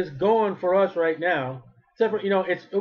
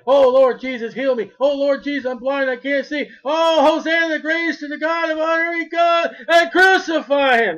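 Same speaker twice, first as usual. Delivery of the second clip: crowd crying out; 7 semitones higher, 5 dB louder than usual. Acted. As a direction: cry out to Jesus like a desperate crowd, then turn to a mob shout. Crowd cries out to Jesus.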